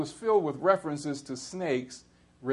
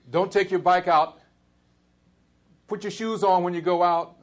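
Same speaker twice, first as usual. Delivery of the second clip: mama's warning, a little higher and louder; mock mama scolding; 5 semitones higher, 6 dB louder than usual. Preacher voicing his mother's warning, mock scolding. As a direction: play mama calling after her child. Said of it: "Mama says".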